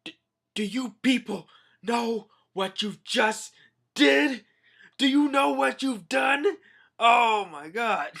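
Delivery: high, loud put-on old man voice; outraged old king. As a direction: scold the crew in disbelief. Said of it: "Papa's all like".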